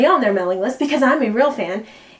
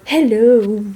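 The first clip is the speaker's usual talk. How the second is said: echoey ghost hello; 3 semitones lower, 4 dB louder than usual. Shown as a demonstration. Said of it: "You have to go".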